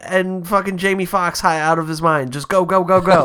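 high-pitched, hustling crew boss; mock frantic urging. Crew boss hurrying someone through the show, mock frantic urging. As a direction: urge them along in a hammed-up panic.

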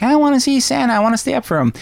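put-on high, eager child's voice; excited little boy. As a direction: bounce with Christmas excitement. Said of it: "initially he was super excited".

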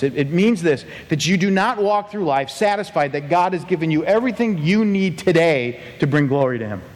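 ordinary delivery

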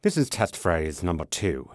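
posh British. Acted. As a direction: go posh English. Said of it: "Yeah, go posh".

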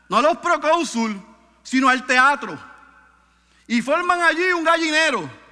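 high, loud emphatic preaching; preaching at full force. Acted. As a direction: hammer each phrase to the people. Preaching with rising fervour.

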